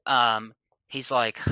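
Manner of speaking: ordinary delivery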